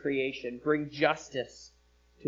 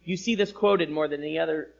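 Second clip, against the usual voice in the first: ordinary talk, nothing marked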